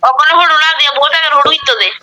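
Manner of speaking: usual speaking voice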